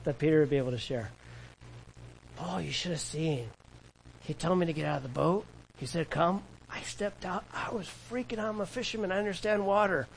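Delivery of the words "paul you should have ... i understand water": hushed, breathless wonder rising in pitch; Peter's acted storytelling. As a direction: recount it softly, voice climbing with disbelief. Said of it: "Peter telling him".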